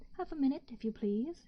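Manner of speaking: a touch of a shout in a whisper; mock shout to the guests; mild shout. A narrator's reading. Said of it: "shouted the man over his shoulder".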